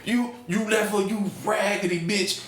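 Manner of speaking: high-pitched heckling at the screen; mock scream of outrage, breaking into laughter; retold screaming. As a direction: yell it like an exasperated moviegoer. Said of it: "I kept screaming".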